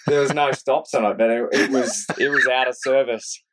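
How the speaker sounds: laughing along, pitch lifted; amused, through laughter. Real, not acted. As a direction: say it while fighting back laughter. Joking amid shared laughter.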